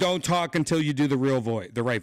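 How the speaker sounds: loud, furious yell cutting the assistant off; angry shout. Real, not acted. Furious user shouting at the assistant to stop talking.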